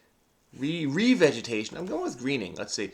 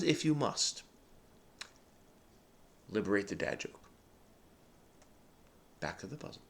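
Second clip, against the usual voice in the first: usual speaking voice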